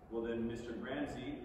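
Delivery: ordinary delivery, nothing marked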